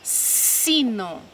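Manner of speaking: "Sino" with a long hissed s at the start; stretched initial sound. Shown as a demonstration. Teacher matching first sounds for children, "Sino" with long s. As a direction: hold the first s of "Sino" before the vowel. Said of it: "Começa pelo mesmo som".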